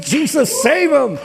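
fervent shouted plea; crying out to Jesus. Retold or imitated. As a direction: call out to Jesus for rescue. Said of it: "I said".

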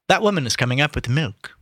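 usual speaking voice

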